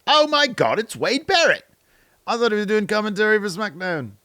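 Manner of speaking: high, excited British-ish gasp of a voice; mock Nerd Cubed impression. Wrestling podcaster mimics a viewer's imagined live reaction, high and excited. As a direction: squeal it like a startled fan spotting Wade Barrett. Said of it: "such a horrible Nerd Cube".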